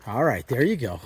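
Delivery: ordinary talk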